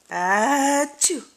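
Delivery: playful put-on sneeze; a hammed-up achoo for the baby; fake sneeze. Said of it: "Mommy gonna sneeze".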